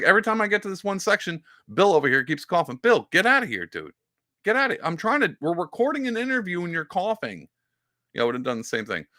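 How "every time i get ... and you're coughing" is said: high-pitched exasperated complaint; played-up irritated star on set; mock exasperation. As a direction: act fed up with an interruption on set. Acts out irritated man being filmed.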